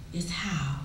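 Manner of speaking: soft, hushed whisper. Acted. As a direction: breathe it like a secret to children. Mother reveals the secret softly.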